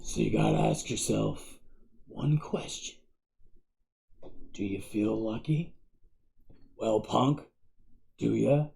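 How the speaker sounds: low, very soft tough-guy menace; near-whispered movie gunslinger threat, with long pauses; Clint Eastwood. Shown as a demonstration. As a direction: drop it low and quiet, taunting. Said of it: "my best Clint Eastwood impersonation".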